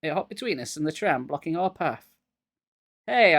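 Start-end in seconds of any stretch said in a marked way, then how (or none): 3.1-3.4 sharp high call of alarm; startled cry; cried out. A narrator's reading. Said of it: "I cried".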